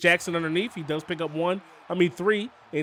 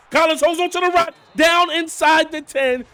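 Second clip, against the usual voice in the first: higher, louder calling of the catch; excited play-by-play; 9 semitones higher, 7 dB louder than usual. Real, not acted. Gamer calls a catch near the end zone, excited shout. Big catch in close game.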